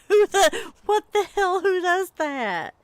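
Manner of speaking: high, loud, laughing who does that; giggling self-mockery; laughing disbelief. Real, not acted. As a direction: crack up at yourself. Laughing at own awkwardness.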